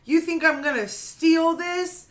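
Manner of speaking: high, loud, slowed outraged demands; raging old woman. Retold or imitated. Retelling the elderly customer raging at the cashier, mock fury. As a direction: hammer each line like an entitled customer losing it. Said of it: "she just starts raging".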